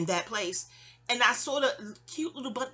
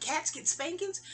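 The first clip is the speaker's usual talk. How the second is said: higher, puzzled question; childlike surprise; 7 semitones higher, slower than usual. Retold or imitated. As a child watching the cat get spanked, higher puzzled question. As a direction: ask it with wide-eyed disbelief. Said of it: "I was like".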